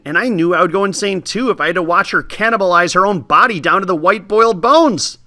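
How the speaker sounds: ordinary delivery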